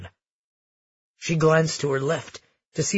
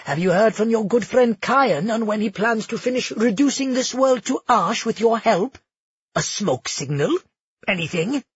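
high, smug taunting voice; smirking taunt; 6 semitones higher than usual. Acted. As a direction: smirk through the jab. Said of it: "Felix smirked".

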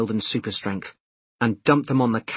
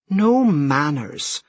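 slow, higher Scottish voice; strong Scottish accent; 5 semitones higher, 3 dB louder, much slower than usual. A narrator's reading. Shop worker as customer leaves, drawn-out strong Scottish accent. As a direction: call it out, drawn out, in broad Scots. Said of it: "in a strong Scottish accent".

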